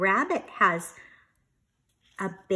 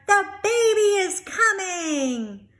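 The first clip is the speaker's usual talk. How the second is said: much higher, laughing cheer; happy shout; 11 semitones higher, 6 dB louder than usual. Acted. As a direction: shout it with joy. Kids cheering.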